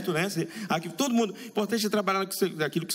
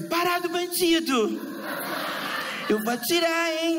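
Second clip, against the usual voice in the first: much higher, slow, sing-song police threat; hammed-up cop; 12 semitones higher, slower than usual. Policeman threatening a bandit, mock sing-song shout. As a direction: play a cartoon cop yelling at a robber. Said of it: "policial assim".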